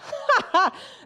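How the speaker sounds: loud high laugh breaking out; burst of laughter. Real, not acted. Laughing at her own nickname for the girl. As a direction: crack up at the nickname.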